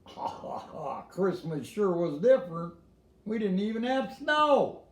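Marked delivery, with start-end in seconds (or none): none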